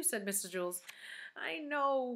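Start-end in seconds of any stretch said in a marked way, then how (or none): 1.4-2.1 put-on high, whiny kid's voice; whining. A narrator's reading. Damien giving in to his teacher, whining. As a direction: whine it reluctantly. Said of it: "whined Damien".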